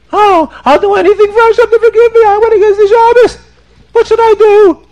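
very high, loud, hurried mock wail; frantic crying. Sinner begs for Hashem's forgiveness, frantic crying. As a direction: beg desperately, bawling like a baby. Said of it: "crying and screaming in the shul like a baby".

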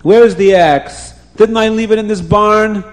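loud, high, impatient question; agitated demand. A narrator's reading. Farmer searching the barn for his axe, agitated.

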